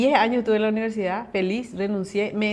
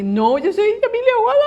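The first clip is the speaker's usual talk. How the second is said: much higher and louder insistence; indignant correction; 12 semitones higher, 7 dB louder than usual. Retold or imitated. Telling the mistaken examiner her real name, loud and high. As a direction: set her straight, pitched up.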